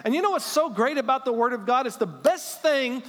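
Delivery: high, loud, fired-up preacher; full-force preaching. Real, not acted. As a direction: preach it at full voice, driving upward.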